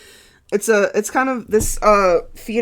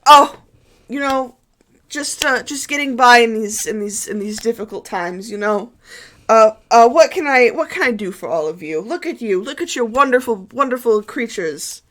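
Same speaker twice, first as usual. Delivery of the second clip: higher, eager priest voice full of fuss; fawning priest; 6 semitones higher, 5 dB louder than usual. Acted. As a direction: fuss over them like a nervous host. GM voicing the priest.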